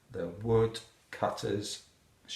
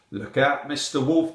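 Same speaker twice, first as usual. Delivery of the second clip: raised voice, lightly acted; mild warning cry; 4 semitones higher, 6 dB louder than usual. A narrator's reading. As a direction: call out a warning as the parrot. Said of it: "cried the parrot".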